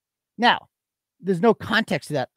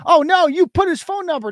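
high, fake-shocked "Oh, no"; mocking whine; 10 semitones higher, 6 dB louder than usual. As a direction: parody their pearl-clutching. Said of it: "They, they did that with the".

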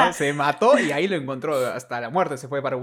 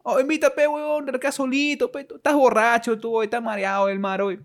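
loud, much higher mocking voice; mocking townsfolk; 12 semitones higher, 7 dB louder than usual. Villagers mocking the fishermen, loud high scoffing voice. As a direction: scoff at the fishermen like a rowdy local.

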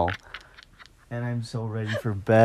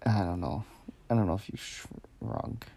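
nodding off; 10 dB quieter than usual. Real, not acted. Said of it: "I'm falling asleep".

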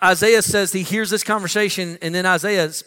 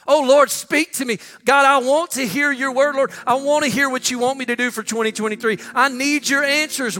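raised, higher-pitched plea to God; acted urgent begging in prayer; pleading prayer; 7 semitones higher than usual. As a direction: cry out for answers, pitch well up. Acting a believer begging God.

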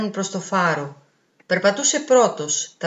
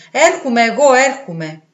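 flustered, louder and higher; 4 semitones higher, 7 dB louder than usual. A narrator's reading. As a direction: hurry to answer, all flustered. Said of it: "λέει ταραγμένος". (ταραγμένα και δυνατά)

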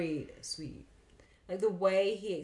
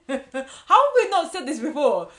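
laughing, much louder and higher; 8 semitones higher, 14 dB louder than usual. Real, not acted. Host cracking up at her own earnest pun.

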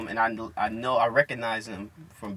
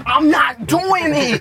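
high, loud, drawn-out protest; hammed-up schoolkid yell; mock shouted denial; 14 semitones higher, 10 dB louder, much slower than usual. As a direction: shout it slowly, insisting on innocence. Imitates classmate's loud denial.

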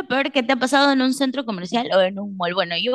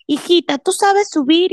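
high, slightly louder worried question; anxious mom voice; 8 semitones higher, 4 dB louder than usual. Retold or imitated. Mother asking her daughter at the escalator, high and anxious. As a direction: fuss like a hen mother.

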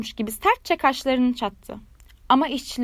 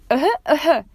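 mock cough; 3 semitones higher, 6 dB louder than usual. A narrator's reading. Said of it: "öksürmeye başladı".

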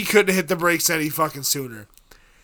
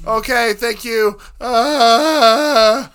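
scared; 5 semitones higher, 5 dB louder, slower than usual. Retold or imitated. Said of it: "I was scared".

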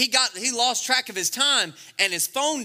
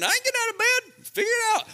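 much higher, snippy falsetto wife; mocking high wife voice; faster than usual. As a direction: squeak it out like an annoyed wife. Wife refusing husband at door.

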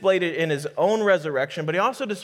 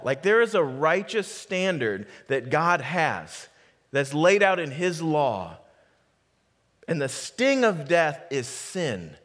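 ordinary talk

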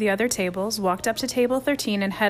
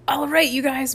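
higher, louder call to bed; bawling; 6 semitones higher, 4 dB louder than usual. A narrator's reading. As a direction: bark the boys to bed. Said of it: "bawled Chip".

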